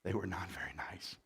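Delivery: whispered aside; hushed confiding aside. Real, not acted. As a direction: breathe it out voiceless.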